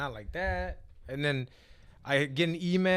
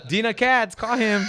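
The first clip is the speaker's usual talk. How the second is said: high, loud shout; high-pitched shouted plug; calling out; 7 semitones higher, 8 dB louder than usual. Pretend. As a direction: holler out for someone to call. Shouting out to booker for gig.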